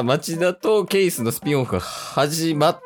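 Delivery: ordinary delivery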